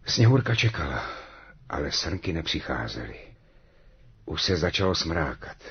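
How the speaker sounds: ordinary delivery, nothing marked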